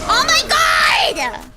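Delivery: high, loud, drawn-out outburst; exasperated shout. Real, not acted. Gamer fed up with a lagging match, exasperated shout. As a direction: cry out in drawn-out frustration.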